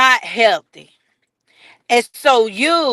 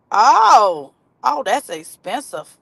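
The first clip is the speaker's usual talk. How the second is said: ordinary talk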